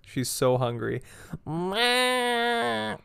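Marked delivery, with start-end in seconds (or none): 1.5-3.0 high, loud meowing; cat meow. Retold or imitated. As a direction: whine like a hungry cat. Imitating hungry cat screaming.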